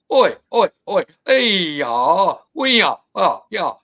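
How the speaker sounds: imitated creature screaming. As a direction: mimic a creature calling in a heated argument. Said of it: "just, just screaming at each other".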